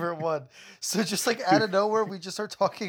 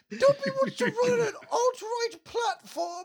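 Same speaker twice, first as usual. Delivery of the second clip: high squeaky house-elf; Dobby voice; 14 semitones higher than usual. Acted. Friend riffing in Dobby voice, high squeak.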